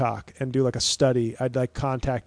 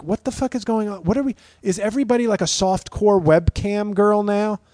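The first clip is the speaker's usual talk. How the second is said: incredulous; 6 semitones higher than usual. Retold or imitated. Reaction to enhanced TikTok videos.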